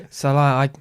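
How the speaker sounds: ordinary talk, nothing marked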